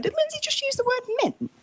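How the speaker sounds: high, incredulous quote; retold surprise. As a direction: ask it in high disbelief.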